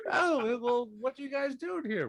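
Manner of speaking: very high, fast little satyr. Acted. Little satyr Zontan greeting everybody, high and fast. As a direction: squeak it out like a small satyr.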